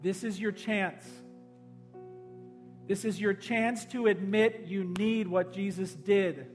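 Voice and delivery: ordinary talk, nothing marked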